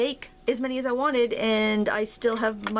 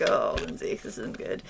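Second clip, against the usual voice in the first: whispering; 13 semitones lower, 3 dB quieter than usual. Retold or imitated. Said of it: "and be like".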